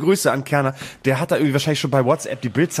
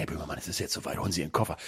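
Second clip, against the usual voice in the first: very soft, low-pitched discreet summons; hushed, confidential retelling; conspiratorial train manager; 10 semitones lower, 12 dB quieter, faster than usual. Retold or imitated. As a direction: imitate an official quietly tipping someone off. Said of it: "Der, der Zugchef kam und sagte".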